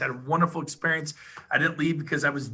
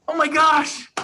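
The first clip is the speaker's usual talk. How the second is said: exasperated; 10 semitones higher, 7 dB louder, slower than usual. Real, not acted. Disbelief at zero referral pay.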